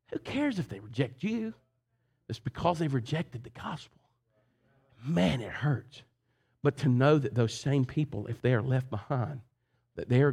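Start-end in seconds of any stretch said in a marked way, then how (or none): 0.1-6.0 hushed whisper to the congregation; breathy confiding whisper. Real, not acted. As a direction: drop to a whisper for weight. Preacher drops to a whisper.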